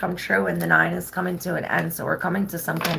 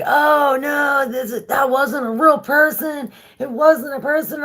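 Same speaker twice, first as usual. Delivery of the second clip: high, loud, frightened shout; freaked out; 8 semitones higher, 4 dB louder than usual. Retold or imitated. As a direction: yell it like you just saw something unreal. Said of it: "freaking flipping out".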